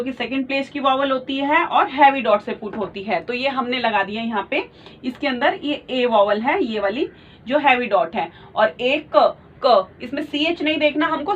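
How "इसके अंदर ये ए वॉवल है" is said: ordinary delivery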